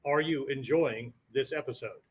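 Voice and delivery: soft, low and flat all the way through "episode"; flat question. Shown as a demonstration. Teacher demos the flat inflection to the class, low and soft. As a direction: say it with no rise at all. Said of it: "It's very flat".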